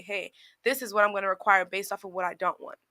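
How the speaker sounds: ordinary talk, nothing marked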